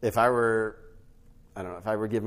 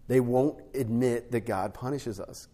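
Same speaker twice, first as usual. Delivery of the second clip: usual speaking voice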